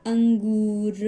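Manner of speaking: अंगूर split after "ang", long "oo"; slight pause. Shown as a demonstration. Teacher showing the nasal sign between two letters, slight pause. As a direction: pause briefly inside अंगूर, then stretch the end. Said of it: "थोड़ा सा pause देकर".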